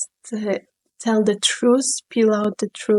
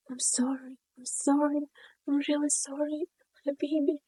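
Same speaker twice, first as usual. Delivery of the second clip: higher, much softer, near a whisper; tender whispered apology; 5 semitones higher, 10 dB quieter than usual. Retold or imitated. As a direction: breathe the apology like soothing a child.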